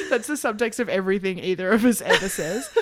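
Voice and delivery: usual speaking voice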